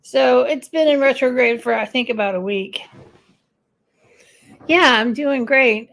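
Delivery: ordinary delivery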